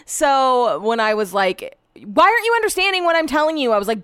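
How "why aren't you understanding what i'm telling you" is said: exasperated, higher and fast, half-shouting; raised voice. Retold or imitated. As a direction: snap at him in frustration. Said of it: "I was raising my voice".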